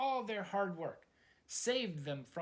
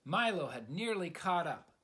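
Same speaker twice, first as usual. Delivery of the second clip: plain reading voice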